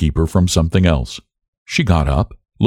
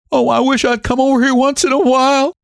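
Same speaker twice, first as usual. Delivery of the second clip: crying out. A narrator's reading. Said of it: "she cried".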